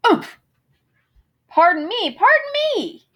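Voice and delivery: bumped, surprised, politely flustered; startled apology. Acted. Two moose colliding, startled and apologizing. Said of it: "they both said as they bumped".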